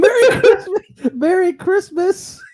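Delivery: loud, high, laughing imitation; mock holiday greeting. Listener echoing the angry customer's parting line, loud laughing mockery. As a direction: crow it back through laughter, high and drawn out.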